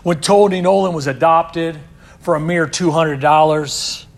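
forceful sermon delivery; loud preaching. Real, not acted. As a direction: proclaim it. Preaching climax.

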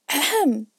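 loud, high self-important ahem; important throat-clearing. A narrator's reading. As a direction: clear the throat to demand attention. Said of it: "with an important air".

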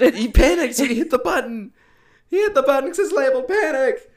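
loud, high mock whine; mocking squeak. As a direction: squeak it out like the clueless kid. Mocking Rob's panic.

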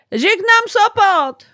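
very high, loud, slow; a star leaving the Sopot stage; mock star farewell. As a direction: sing out the goodbye grandly.

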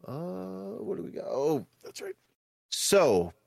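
ordinary delivery

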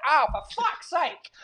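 hammed-up frustration after a glitch; exasperated voiceover. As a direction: drag it out like a staged fed-up wail. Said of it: "I'll record my voiceover just going".

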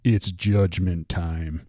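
slow low gravelly threat. Retold or imitated. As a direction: drag it out grim and gravelly. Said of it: "He's got this low, gravelly voice".

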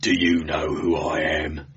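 low, domineering growl. A narrator's reading. Guardsman looming over the prisoners, low menacing growl. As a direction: growl it down at shrinking prey. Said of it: "he growled".

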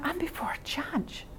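hushed, breathless whisper; a startled whisper in prayer; awed whisper. Retold or imitated. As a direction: whisper it as a sudden realization. Inner realization while praying.

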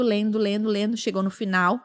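ordinary talk, nothing marked

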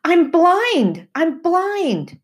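high, loud terrified cry; screamed in panic. Acted. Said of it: "I screamed to Timothy".

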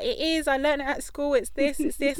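insistent nephew. Retold or imitated. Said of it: "And it was like".

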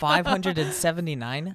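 loud, high disbelief; can't believe the number; surprised. Real, not acted. Disbelief at comment count.

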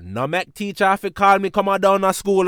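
mock mom warning him sternly; imitating mom. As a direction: son doing his mother's warning. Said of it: "'Cause my mom always said".

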